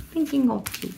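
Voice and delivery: "Thinking of You" said high in a playful mock English accent. Shown as a demonstration. Vlogger repeating the stamp name "Thinking of You" to viewers in a jokey English accent. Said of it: "Un super accent anglais".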